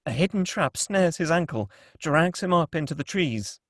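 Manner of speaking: ordinary talk, nothing marked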